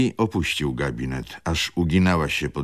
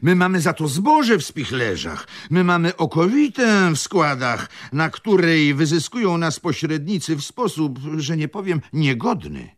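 higher, plaintive complaining nobleman; whiny tearful voice; 13 semitones higher, 5 dB louder than usual. A narrator's reading. Shaven nobleman complains to the gathered gentlemen, tearful whine. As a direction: whine it as a wronged landowner. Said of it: "ciągnął dalej płaczliwym głosem".

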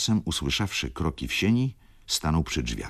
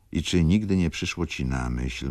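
usual speaking voice

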